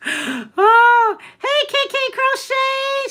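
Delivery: very high, drawn-out squealed hello; delighted high-pitched welcome; excited greeting. Real, not acted. Sing-song greeting to a viewer.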